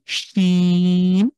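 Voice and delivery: very slow শিন said firmly; slow firm sheen. Shown as a demonstration. Teacher slowly demonstrates a firm শিন. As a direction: drag out শিন with a firm start. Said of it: "একটু শক্ত করে".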